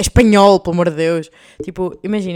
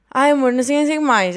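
higher, louder, sugary imitation; higher and louder, mocking a sweet call to a friend; 6 semitones higher, 6 dB louder than usual. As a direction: gush it like a pet name. Mimicking calling a boy love.

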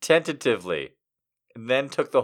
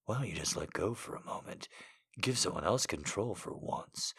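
low, soft, seductive man; seductive whisper; 6 semitones lower, 16 dB quieter than usual. Acted. Seductive male voice.

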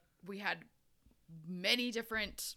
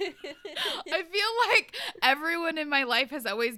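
laughing; 7 semitones higher, 9 dB louder than usual. Real, not acted. Laughing while telling.

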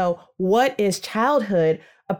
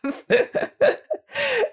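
high laugh. Real, not acted. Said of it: "It makes me laugh".

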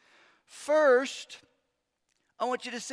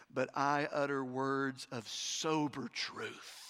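slow sober reply; 6 semitones lower, slower than usual. Acted. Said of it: "Paul says".

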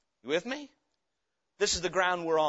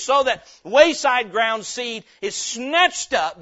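loud, driving sermon delivery; full-voiced pulpit preaching; fiery preaching; 4 semitones higher, 8 dB louder than usual. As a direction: preach it hard to the congregation. Preacher building to full force.

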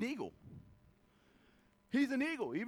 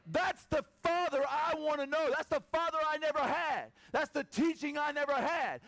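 heartfelt yearning; 6 semitones higher than usual. Retold or imitated. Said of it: "you're saying".

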